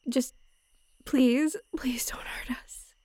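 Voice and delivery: shaky pleading whisper; frightened whisper. Acted. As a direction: beg in a scared whisper. Begging in a whisper.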